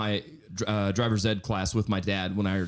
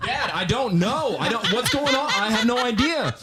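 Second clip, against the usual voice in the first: high, laughing, slowed-down protest to dad; laughing mock panic; 12 semitones higher than usual. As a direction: play the panicked son through laughter.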